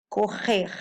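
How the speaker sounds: correr with both R sounds as throaty H; Portuguese R sounds. Shown as a demonstration. Teacher showing double and final R, correr with H sounds. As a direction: say correr like "ko-hehh". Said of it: "we can pronounce this word".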